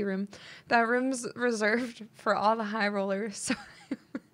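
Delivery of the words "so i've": giggle. Real, not acted. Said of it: "Why am I laughing?".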